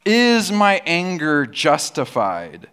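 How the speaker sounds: slow, thoughtful questions to yourself; slow self-questioning. Retold or imitated. Preacher modelling self-examination, slowly questioning. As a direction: ask it slowly, weighing each word. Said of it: "We need to ask".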